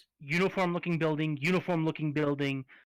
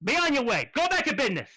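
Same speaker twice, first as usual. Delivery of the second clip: high, loud wiseguy raccoon picking a fight; angry tough-guy raccoon; 8 semitones higher, 4 dB louder, faster than usual. Acted. Raccoon in a cage picks a fight with the party, loud and high. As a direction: bark at them like a caged street tough.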